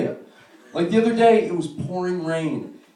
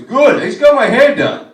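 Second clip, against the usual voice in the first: prissy fisherman voice; 8 semitones higher, 11 dB louder than usual. Retold or imitated. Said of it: "And he goes".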